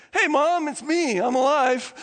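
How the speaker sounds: chipper voice. Acted. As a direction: burst in cheerfully with the good news. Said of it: "a chipper voice saying".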